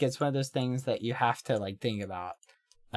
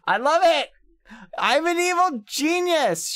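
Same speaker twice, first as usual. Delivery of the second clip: high, excited squeal of triumph; giddy mock-villain boasting, pitched way up; gleeful gloating; 13 semitones higher, 4 dB louder than usual. Real, not acted. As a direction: crow in a high, thrilled voice. Gloating as self-styled evil genius.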